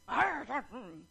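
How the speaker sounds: loud Mufasa-like growl trailing into a hum; mock lion growl, tapering off; forceful mock growl. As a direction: growl to show authority. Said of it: "dadaanin sa lakas ng voice".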